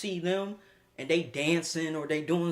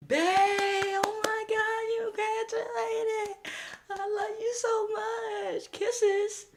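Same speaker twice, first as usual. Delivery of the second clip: put-on high, slow, gushing girlfriend; girly high voice; much slower than usual. Girlfriend gushing over graduate.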